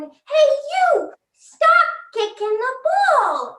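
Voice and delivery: high, drawn-out mock shout; shouting fans. Fans shouting down to the players, high mock shout. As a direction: holler at the players from the stands. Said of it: "They start shouting".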